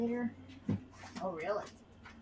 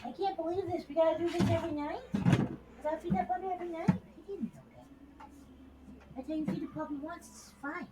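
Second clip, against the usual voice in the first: high-pitched put-on dog voice; voicing the dog; 7 semitones higher, 5 dB quieter, faster than usual. Owner speaking for her dog in a high voice. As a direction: speak as the grumbling puppy, pitched up.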